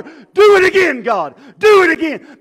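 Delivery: loud high cry, slowed; full-voiced shout of longing; shouted plea. Crying out to God in sermon.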